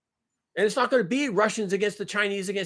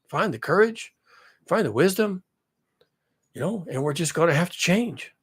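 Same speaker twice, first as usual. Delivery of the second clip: a mild, folksy presidential address in a low hush; Joe Biden impression; 6 semitones lower than usual. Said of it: "I can't do a Joe Biden impression".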